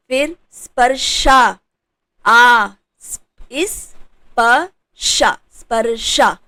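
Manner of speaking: स्पर्शा broken up very slowly and loudly; syllable by syllable. Shown as a demonstration. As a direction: drag out each piece of स्पर्शा. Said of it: "तो यहाँ पर आ". (स्पर्शा को टुकड़ों में)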